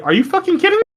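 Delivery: high disbelieving cry; shocked disbelief. Retold or imitated. Aunt pulls a knife on him, high shocked disbelief. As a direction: gasp it out in disbelief.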